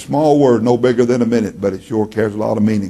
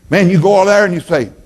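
ordinary delivery

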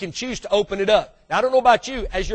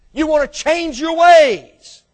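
preaching force; 7 semitones higher, 8 dB louder, slower than usual. Real, not acted. Preaching climax.

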